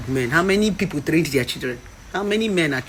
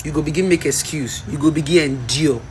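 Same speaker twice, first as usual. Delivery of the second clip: ordinary talk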